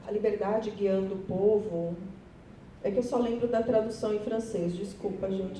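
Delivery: ordinary delivery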